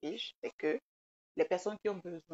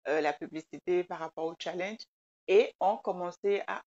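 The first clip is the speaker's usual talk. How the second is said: normal talking voice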